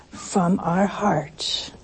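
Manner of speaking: slow, hushed whisper; a slow whisper from the heart. Real, not acted. Intimate point in a sermon.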